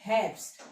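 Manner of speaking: हेrbst as "heps-t", B hardened to P; German pronunciation. Shown as a demonstration. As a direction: say हेrbst in one syllable, R barely there. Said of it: "autumn को हम कहते हैं".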